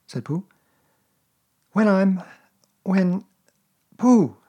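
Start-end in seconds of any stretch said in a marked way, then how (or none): 1.7-4.4 put-on higher, brighter boy's voice asking; a small boy catching on; boyish Christopher Robin. A narrator's reading.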